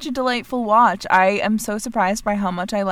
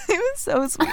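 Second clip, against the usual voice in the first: crying laughing; 9 semitones higher than usual. Real, not acted. Gushing about character.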